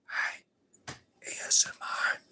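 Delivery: soft whispered puppet voice; ASMR whisper. Acted. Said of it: "very, very quietly".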